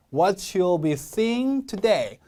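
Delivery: very slow "What you'll be seeing today", today stressed; slow model reading. Shown as a demonstration. Teacher models the closing phrase, slow and emphatic. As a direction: draw the phrase out, leaning on "today". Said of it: "보였다 하면 세게 읽어야".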